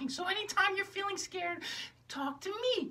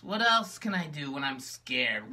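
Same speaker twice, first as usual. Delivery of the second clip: much lower, slow, mopey puppet voice; gloomy low puppet. Acted. Magenta tells her sister how lonely she is, low and gloomy. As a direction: sigh it out like a sad puppet.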